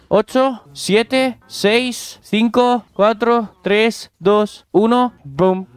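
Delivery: high, eager countdown to a boom; counting the dragon's growth down, excited. Real, not acted. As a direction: count down like a launch.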